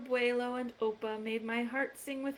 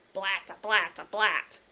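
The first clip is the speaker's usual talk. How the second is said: trumpet imitation; 3 semitones lower, 5 dB louder than usual. Trumpet sound in book.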